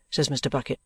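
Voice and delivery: usual speaking voice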